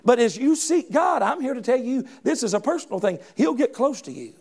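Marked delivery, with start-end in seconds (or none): none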